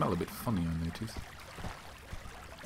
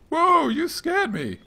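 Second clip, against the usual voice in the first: startled cry; 7 dB louder, much slower than usual. Acted. Startled character in game dialogue.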